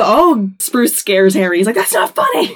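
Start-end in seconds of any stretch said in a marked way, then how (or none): 1.8-2.5 high whiny protest. Retold or imitated. Harry after being scared.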